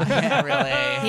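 laughing through words; can't stop laughing. Real, not acted.

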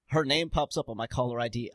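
ordinary talk, nothing marked

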